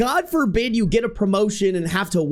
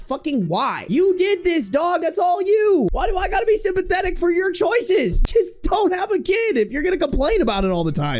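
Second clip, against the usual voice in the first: exasperated shout; 10 semitones higher than usual. Retold or imitated. Telling parents online to stop complaining, shouting high. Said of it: "I'm like".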